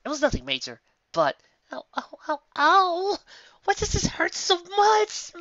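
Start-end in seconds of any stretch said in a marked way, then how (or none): 1.7-5.3 high, slow pained whine; whiny pained wail. Acted. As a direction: whimper over a tiny cut. Lyra hurting from paper cut.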